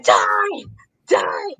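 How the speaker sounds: imitated scream with a drawn-out "die"; mock-yell it like a wrestler cursing a rival. Fan retells Seth screaming at Punk, mock scream. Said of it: "Seth is screaming".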